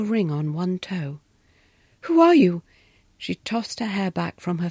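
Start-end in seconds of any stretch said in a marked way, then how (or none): 2.0-2.6 sharp, high question; startled challenge. A narrator's reading. Governess confronting a stranger at night, high and startled. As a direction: demand it, caught off guard.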